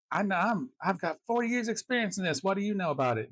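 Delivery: high, indignant mock bluster; retold angry bluster. Realtor bristling at anyone questioning his authority, mock indignant. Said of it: "immediately would get angry".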